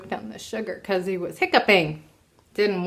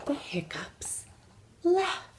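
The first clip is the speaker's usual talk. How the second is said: soft whispered storybook close; whispered ending; 5 semitones higher, 10 dB quieter, slower than usual. A narrator's reading. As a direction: breathe the last words softly.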